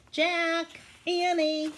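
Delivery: high, loud call from afar. Acted. Mother calling her children home from the woods, loud. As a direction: call the kids home from a distance. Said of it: "their mother calling them".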